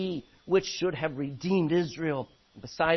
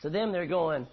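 usual speaking voice

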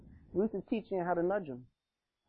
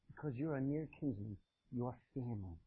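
very soft, low, gentle words; hushed appeal; 8 semitones lower, 12 dB quieter than usual. Acted. Woman to her near kinsman, hushed. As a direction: quietly remind him, almost under the breath. Ruth's quiet appeal to Boaz.

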